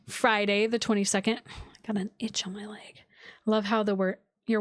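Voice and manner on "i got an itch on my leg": whisper. Real, not acted. Live chat aside to viewers.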